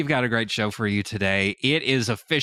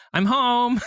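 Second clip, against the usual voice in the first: voicing husband; 13 semitones higher, 3 dB louder than usual. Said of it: "Dallas walks in and says".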